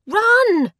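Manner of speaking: very high, very loud yell. Acted. Lizzie yelling at her friend to escape the brother, urgent. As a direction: yell it as a warning to flee. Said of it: "Lizzie yelled".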